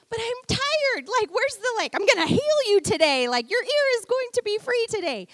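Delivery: high, weary complaint to God; half-joking exasperated prayer; pleading to God. Retold or imitated. Pastor complaining to God about illness, exasperated. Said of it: "I kinda was like".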